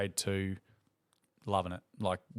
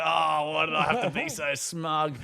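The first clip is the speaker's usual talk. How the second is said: mock despair; 6 semitones higher, 3 dB louder than usual. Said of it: "I'm like".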